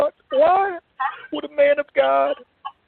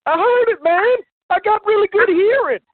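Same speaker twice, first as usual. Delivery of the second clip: louder, higher insistence; insistent; 7 semitones higher, 5 dB louder than usual. Acted. Donnie insists to Tony he heard the scratching, raised insistent voice.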